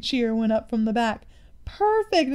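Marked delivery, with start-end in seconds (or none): 1.7-2.3 high, bright burst of delight, a little louder; a boy cheering the new van name, much higher; exclaimed. A narrator's reading. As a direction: leap up high on the word in glee. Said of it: "exclaimed Sam".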